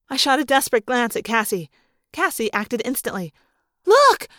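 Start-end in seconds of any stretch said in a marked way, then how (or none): 3.9-4.3 sudden high, loud cry; urgent high-pitched exclamation. A narrator's reading. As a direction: shout it to grab everyone's attention. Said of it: "she cried".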